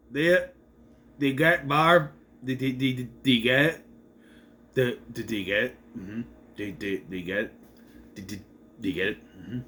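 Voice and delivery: fading mock nudge. Recapper running the Barb joke into the ground, fading nudge. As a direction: keep nudging, dropping gradually in pitch and volume.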